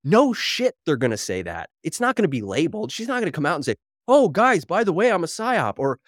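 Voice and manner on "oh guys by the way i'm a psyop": higher, louder, hammed-up sing-song confession; mock confession. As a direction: play her cheerfully owning up.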